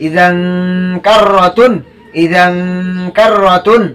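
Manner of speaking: ইজান with a long nasal "ng" hum before কাররাতুন; hidden n, ghunnah. Shown as a demonstration. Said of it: "গুণনা করতে হবে".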